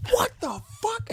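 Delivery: sudden squeaky high gasp of shock; shocked Aunt May. Retold or imitated. Aunt May catching him at her window, shrill shock.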